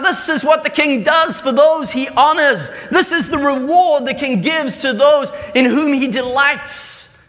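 high, loud herald's cry; proclaiming shout. Acted. Herald shouting through the city streets, high and loud. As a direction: announce it to the whole city. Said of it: "have them shout".

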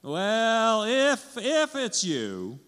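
high, hesitant, drawn-out hedging; wavering doubt. Acted. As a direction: waver between believing and doubting, pitched high. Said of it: "in between that belief and doubt".

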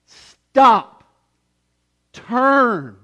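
loud, high call to stop; God calls out to the lost. Retold or imitated. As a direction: cry out like a father in alarm. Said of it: "our Father in heaven calls out".

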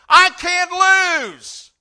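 high, slow, ringing shout; triumphant cry. Real, not acted. Pastor shouting his sure hope to the church.

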